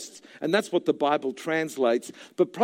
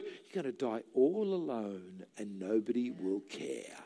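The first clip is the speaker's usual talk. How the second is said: much lower, much softer sinister devil voice; sinister devil whisper; 9 semitones lower, 12 dB quieter than usual. Retold or imitated. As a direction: drop low and soft like a menacing devil. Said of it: "Or another word".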